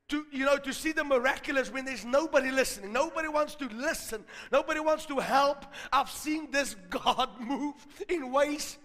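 loud, high full-force preaching; impassioned sermon climax. Real, not acted. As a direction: build to a shouted exhortation to hold on.

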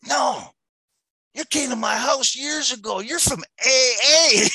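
a lightly voiced drunk, delighted to remember; imitating the rounder. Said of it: "He goes".

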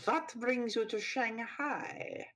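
slow German villain drawl; slow, sneering German accent. Acted. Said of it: "Are you mocking my accent".

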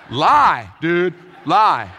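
loud, laughing call to lie; emphatic shout. Acted. Pastor shouting his answer to the student, loud and laughing. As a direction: belt it out through a laugh.

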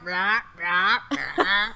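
croaky frog sounds. Retold or imitated. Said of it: "They're like".

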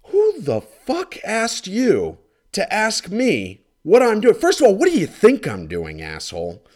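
high, loud, incredulous rant; furious comic rant about the neighbor; exasperated rant. Real, not acted. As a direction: let the outrage burst out. Ranting at nosy neighbor.

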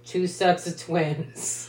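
slow, dry deadpan; deadpan slow answer. Retold or imitated. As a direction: drop it flatly and slowly. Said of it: "And he's like".

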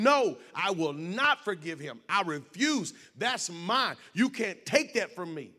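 mild mock yell, punched refusals; retold yell. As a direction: dig in stubbornly without a full shout. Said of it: "I yelled at God again and told him".

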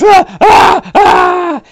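repeated high pained shouts; acted death cries; dying screams. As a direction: cry out swatting at searing butterflies. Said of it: "and he goes".

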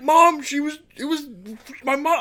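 very high, loud mock teen; teen scolding mom; exasperated kid. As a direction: squeal it at mom. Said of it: "I was like".

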